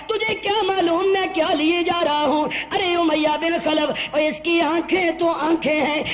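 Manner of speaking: loud, slow, soaring sermon climax; rising sermon peak, drawn out; fiery preaching. Real, not acted. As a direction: preach it out over the crowd, slowly. Preaching climax at full force.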